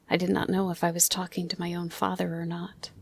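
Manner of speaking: plain reading voice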